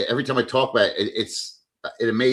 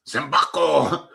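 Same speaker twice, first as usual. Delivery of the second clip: mimicking a Filipina; 4 semitones higher, 4 dB louder, slower than usual. Mimicking Filipina word, slow.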